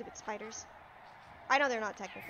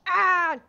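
loud raspy yell that hurts the throat; gravelly battle yell; 5 semitones higher, 11 dB louder than usual. Real, not acted. Attacking, a loud throat-scraping yell. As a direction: let out a strained war cry. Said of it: "That hurt my throat".

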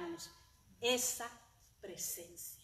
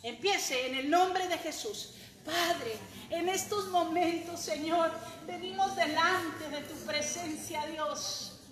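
fervent prayer; 7 semitones higher, 15 dB louder than usual. Acted. Preacher leading the congregation in prayer, at full force. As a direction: lead the church in a fiery prayer.

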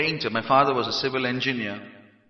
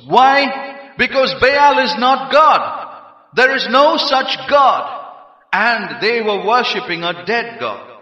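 loud, high, emphatic proclamation; full-force preaching; 7 semitones higher, 8 dB louder than usual. Real, not acted. Preacher declares Baal false to the congregation, forceful preaching. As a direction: drive it home slowly in a raised pulpit voice.

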